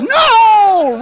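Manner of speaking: high, loud, drawn-out no; mock dismay. Pastor reacting to a peacemaking meeting canceled for conflict, loud mock dismay. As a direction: play it as comic disbelief.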